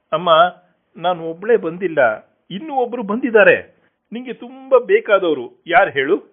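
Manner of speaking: coaxing a deaf mother. Acted. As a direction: speak up high into an old woman's ear. Sister speaking into deaf ear.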